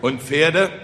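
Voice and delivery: understated worried villagers; anxious question. Acted. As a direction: ask warily, a touch afraid of the answer. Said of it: "fragten ängstlich die Bauern".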